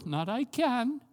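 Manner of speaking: usual speaking voice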